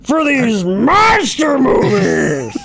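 slow, high, drawn-out mock shout; stretched-out theatrical yelling; yelly impression. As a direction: holler it like a hammy movie star. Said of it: "He's very yelly".

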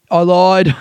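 loud, high blurt; blurted confession. Pretend. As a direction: blurt out the lie.

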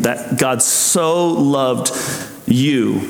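that God so loved, slowly, with the word so held and stressed. Shown as a demonstration. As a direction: linger on so before the word you. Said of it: "so" in that statement".

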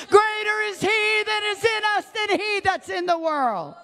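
high, sustained preaching cry; fervent prayer shout. Real, not acted. Preacher begging God for help to conquer the flesh, fervent shout. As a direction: call out to God at full force, stretching the big words.

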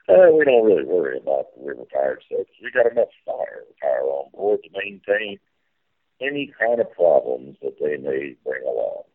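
riverboat captain spiel. Pretend.

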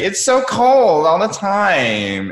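high, slow whiny tourist voice; mock the cold, underdressed visitors; whiny complaint. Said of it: "and they'll be like".

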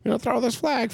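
mock referee justifying the flag, high and slow. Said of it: "he's like".

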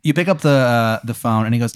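ordinary talk

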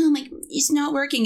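hammed-up annoyed grumble; mock annoyance. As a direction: mimic an exasperated coworker, rushed. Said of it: "they, like, get so annoyed".

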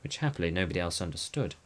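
plain reading voice

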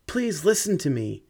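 high, desperate plea; desperate pleading. Acted. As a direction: beg with a breaking voice. Begging while crawling.

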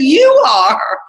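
a hint of the producer insisting; insistent quote.

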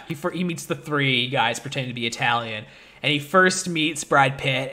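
usual speaking voice

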